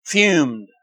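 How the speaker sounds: ordinary talk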